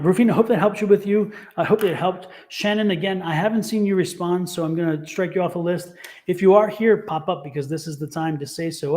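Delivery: usual speaking voice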